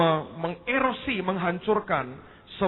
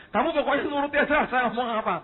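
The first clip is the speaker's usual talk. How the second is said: bossy order; 10 semitones higher than usual. Retold or imitated. Preacher orders the translator.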